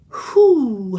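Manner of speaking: normal talking voice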